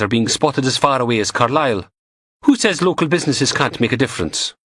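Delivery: brisk pathologist sharing news; lightly voiced Samantha. A narrator's reading.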